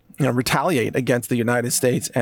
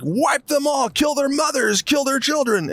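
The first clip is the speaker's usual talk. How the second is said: mock bloodthirsty leader; 10 semitones higher than usual. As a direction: caricature a leader calling for slaughter, higher. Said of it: "It's like".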